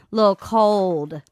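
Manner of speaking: usual speaking voice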